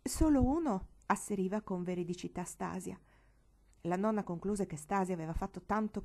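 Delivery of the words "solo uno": incredulous. Acted. Stasia's retort, repeated plainly later.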